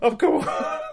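very high squeal through laughter; laughing disbelief. Retold or imitated. Podcaster mocking own doubt about the casting, laughing disbelief. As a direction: crack up while protesting in mock disbelief. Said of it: "I was like".

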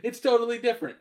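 high, loud mock objection; indignant outburst. Imagined Australian listener objecting, loud and high mock outrage.

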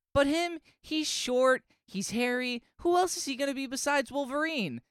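high, put-on arguing voice; mock protest. Howard pointing out Wolverine's limited options, high mock protest. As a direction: argue it with comic exasperation.

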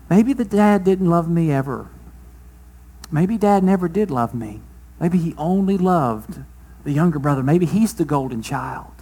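muttering doubts to himself, wounded; quiet self-doubt. Acted. Older brother doubting his father's love, quiet and hurt.

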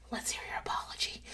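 teasing breathy whisper; whispered tease. Retold or imitated. Wife to husband, teasing whisper. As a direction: whisper it with a smug grin. Mock-gracious welcome continues.

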